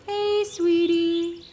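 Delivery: high slow whistled call; chickadee song. Shown as a demonstration. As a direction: sing it like a chickadee. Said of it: "Make one of the three".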